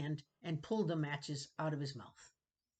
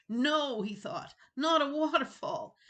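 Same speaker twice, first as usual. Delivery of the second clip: alarmed and pitched up, louder; sudden dread as the water roars; 9 semitones higher, 7 dB louder than usual. Acted. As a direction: dread breaking in on "not a waterfall". Realising danger, alarmed.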